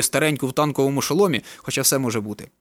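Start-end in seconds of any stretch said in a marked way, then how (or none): none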